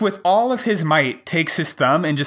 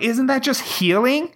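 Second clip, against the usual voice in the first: 1 incredulous; 9 semitones higher than usual. Retold or imitated. Incredulous question to chiropractor.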